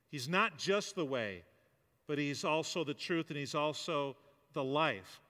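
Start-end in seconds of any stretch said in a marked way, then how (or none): none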